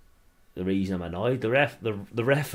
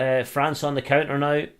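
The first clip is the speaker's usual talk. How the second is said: live play-by-play; 3 semitones higher than usual. Acted. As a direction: call the attack like a pundit on the telly. Fan commentating the match live.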